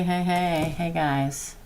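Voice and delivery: ordinary delivery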